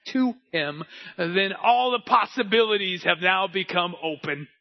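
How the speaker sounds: loud, high, driving sermon climax; fired-up preacher hammering the point home; preaching at full force. Real, not acted. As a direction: build to a shouted, ringing proclamation.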